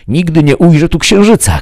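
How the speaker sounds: high, loud outburst; shouted cry. A narrator's reading. Ikelos swears he will never see the moon, shouting. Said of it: "krzyknął".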